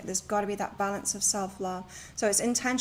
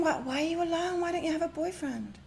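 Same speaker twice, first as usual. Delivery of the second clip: higher, prying question; a friend quizzing her about being single, higher; imitating the friend; 7 semitones higher than usual. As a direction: pitch it up and nosy. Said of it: "She was saying".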